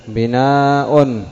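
بناء very slow, glottal stop then -un; hamza with case ending. Shown as a demonstration. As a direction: draw out بناء as binaa-'un. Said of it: "الهمزة بدل الياء".

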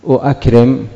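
أؤكرم as "u-akrim", two glottal stops; heavy double hamza. Shown as a demonstration. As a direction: sound both "u" and "a" opening أؤكرم. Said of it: "ثقيلة". (أؤكرم بهمزتين متتاليتين، مضمومة فمفتوحة)

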